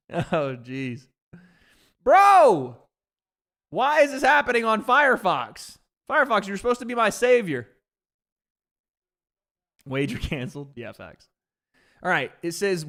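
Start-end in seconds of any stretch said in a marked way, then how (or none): 2.0-7.7 loud, high exasperated outburst; frustrated, raised-voice complaint. Real, not acted. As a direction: yell at the glitching browser. Stream fails, complains at browser.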